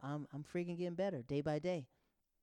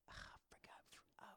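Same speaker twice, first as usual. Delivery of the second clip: whispered aside; 21 dB quieter than usual. Real, not acted.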